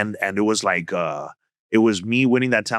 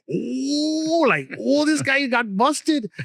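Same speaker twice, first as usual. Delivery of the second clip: much higher mock gasp, slow on busted; crowd reaction; 13 semitones higher, much slower than usual. Students seeing him caught, high mock gasp. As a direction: play the shocked kids.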